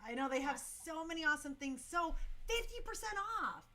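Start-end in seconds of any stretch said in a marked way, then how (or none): 2.4-3.6 excited plug. Real, not acted. Host hyping the discount to viewers, excited. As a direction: punch up the discount.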